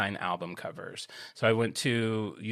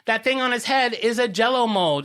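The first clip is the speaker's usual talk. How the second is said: much higher, louder, near shouting; excited reveal; 8 semitones higher, 4 dB louder than usual. Real, not acted. Telling her cohost the logo secret, excitedly near-shouting. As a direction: blurt out the discovery.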